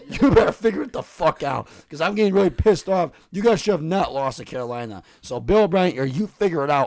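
roaring hammed-up fury at the team; big mad rant. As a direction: scream threats at the coach, half for show. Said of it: "I'm activating my big mad".

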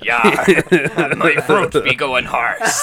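slow pirate voice, laughing. Pretend. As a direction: laugh through a pirate line. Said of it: "I'm gonna stop the pirate voice".

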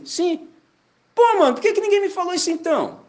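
like someone who just found out the secret; excited outburst. Retold or imitated. New believer exclaiming that nobody told him, excited.

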